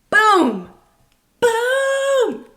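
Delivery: loud boom, the second one leaping high; triumphant boom. Real, not acted. Vlogger cheering her own advice, loud triumphant boom. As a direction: shout it out like a mic drop. Celebratory exclamation after point.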